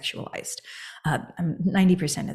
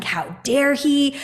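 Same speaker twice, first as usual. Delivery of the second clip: indignant outburst; 7 semitones higher, 3 dB louder, much slower than usual. Retold or imitated. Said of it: "I'm really in my feelings about it".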